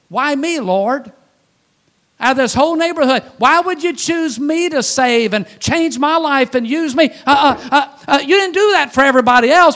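loud, high and pressing, with a laugh breaking in; pleading outburst to God. Retold or imitated. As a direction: cry out to the Lord in amazed disbelief. Preacher voicing his own prayer.

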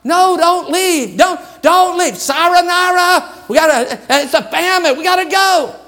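put-on high, pleading runaway kid voice; pleading child. Runaway child's goodbye acted out.

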